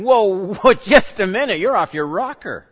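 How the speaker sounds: scoffing at Jesus, loud and amused; mock alarmed objection. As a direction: play a Pharisee stopping him short, half laughing. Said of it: "I don't blame the Pharisees a bit for saying".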